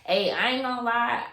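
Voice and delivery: usual speaking voice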